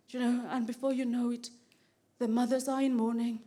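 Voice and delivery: low, hushed, solemn storytelling; mock elegy, subdued and low; mock-mournful. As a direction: drop low and soft, pausing gravely between phrases. Mock-solemn account of neighbourhood decline.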